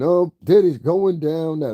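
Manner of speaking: high, drawn-out mock announcement; mocking gossip host. Mocking a gossip YouTuber's opener, high and gloating. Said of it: "that's how she always starts off".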